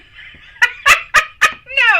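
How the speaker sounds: sudden shrieking laugh; big high laugh; high burst of laughter. Real, not acted. Laughing at own joke.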